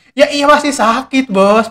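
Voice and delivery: high-pitched, emphatic "of course"; mock exasperated retort. Speaker answering someone who says it still hurts, mock exasperated. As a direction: state the obvious to a silly complainer.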